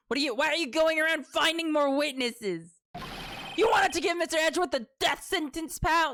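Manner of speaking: high-pitched, accusing detective voice; indignant detective. Acted. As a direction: scold as a worked-up cop. Detective scolds in the game.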